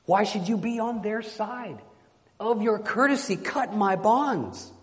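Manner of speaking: high, insistent begging; impassioned plea. Acted. Bound knight to his guests, high impassioned plea.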